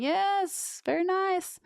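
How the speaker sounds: high, chirpy agreement. Retold or imitated. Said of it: "I'm like".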